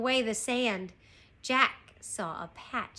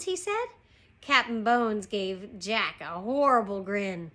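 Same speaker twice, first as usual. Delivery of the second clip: ordinary delivery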